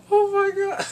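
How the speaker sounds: very high, loud exclamation; shocked delight. Retold or imitated. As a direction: squeal it as a stunned viewer. Repeats own shocked reaction.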